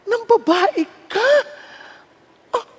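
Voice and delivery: high, drawn-out indignant cry; comic high-pitched outrage; mock outraged wife. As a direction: play a scandalized confrontation, hammed-up. Acted quarrel with another woman.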